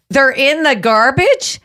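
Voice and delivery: slow, high, disbelieving question; incredulous question. Acted. Questioning a kitchen worker about discarded donuts, incredulous. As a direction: rise in disbelief to the end.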